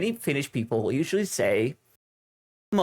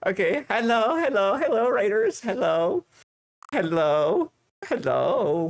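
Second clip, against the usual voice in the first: high, bright hellos calling out; excited greeting; 9 semitones higher than usual. Real, not acted. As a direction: welcome the raiders with energy. Greeting arriving raiders.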